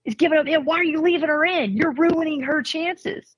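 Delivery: mock panic over her kid; upset parent.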